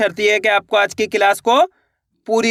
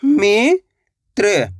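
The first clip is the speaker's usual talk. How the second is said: Mitra stretched very slowly as मि-त्र; very slow drawn-out; much slower than usual. Shown as a demonstration. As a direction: draw the word out for the class. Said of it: "दोनों को मिला देंगे तो". (मित्र को बहुत धीरे खींचकर)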